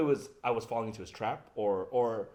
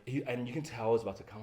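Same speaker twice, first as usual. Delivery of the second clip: ordinary talk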